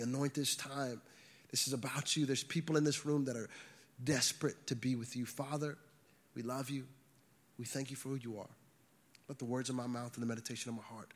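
hushed, low prayer voice; soft, intimate prayer. Real, not acted. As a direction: pray softly and low, sincerely to God. Leads the congregation in prayer.

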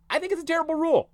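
high, fast, exasperated put-on voice; exasperated rant. Real, not acted. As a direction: spit it out in mock outrage. Venting about a trivial rule.

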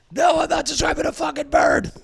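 high-pitched exasperated shout; acted outraged yell, voice shooting way up; pissed-off shout. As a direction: shout it, fed up at not being believed. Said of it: "they're getting all pissed off 'cause no one's believing them".

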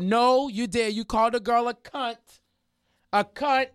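high, insistent call-out; indignant accusation. Retold or imitated. Calling him out mid-argument, indignant. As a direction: accuse them so everyone can hear.

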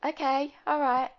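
smooth things over; placating. Retold or imitated. Calming her angry fiancé, placating. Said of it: "And I'd be just like".

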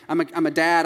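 normal talking voice

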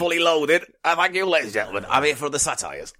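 hammed-up cabaret sign-off; mock stage comic. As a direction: take a hammy bow after a pun. Mock stage comedian after pun.

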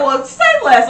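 high, loud, gleeful quip; retold eager agreement. Hearing the parade fell on the off day, gleeful agreement. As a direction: jump at the chance, high and loud. Retells delighted reply about day off.

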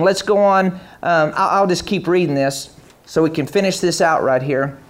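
normal talking voice

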